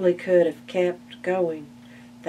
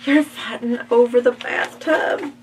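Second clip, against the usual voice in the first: shocked; 6 semitones higher, 6 dB louder than usual. Acted. Shocked at squabbling pigs.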